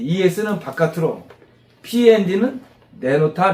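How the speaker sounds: ordinary delivery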